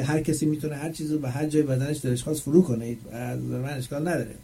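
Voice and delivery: usual speaking voice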